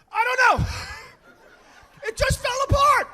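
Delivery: high, loud protesting wail; flustered denial. Retold or imitated. Dad caught out by his son, wailing denial. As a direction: cry out helplessly that it's not your fault.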